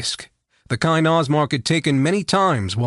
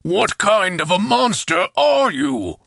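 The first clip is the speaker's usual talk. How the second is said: slow, higher, shaken voice; frightened chieftain; 6 semitones higher, slower than usual. A narrator's reading. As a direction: ask it in dawning fear.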